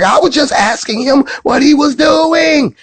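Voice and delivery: very high-pitched shouted protest; mock indignant wife. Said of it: "She was like".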